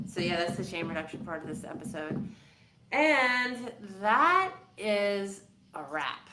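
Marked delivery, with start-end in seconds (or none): 2.9-6.3 drawn-out sign-off. Real, not acted. Closing the episode.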